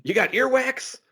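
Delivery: high, drawn-up imitation of a robber's shout; mock tough yell. Podcaster repeating the robber's silly line, high mock yell. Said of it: "you hear him yell out".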